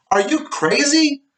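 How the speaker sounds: very high, slow cry of disbelief; shocked shout. Acted. Mother screaming at husband who tore the speech. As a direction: shriek it at him in horror. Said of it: "My mother lunged at him".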